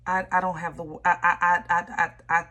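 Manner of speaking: stuck on the word I, overwhelmed; real stammer, too moved to get the words out; emotional stutter. Real, not acted. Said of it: "I'm stuttering".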